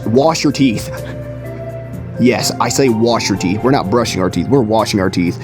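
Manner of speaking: normal talking voice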